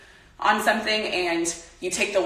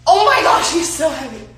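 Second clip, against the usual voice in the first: high, loud cry of effort; high-pitched outburst of exertion, easing off at the end; straining under weight; 6 semitones higher, 7 dB louder than usual. Real, not acted. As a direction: yelp it while lifting something very heavy. Squatting with the heavy dog.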